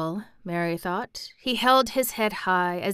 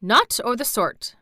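usual speaking voice

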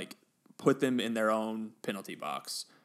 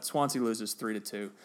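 usual speaking voice

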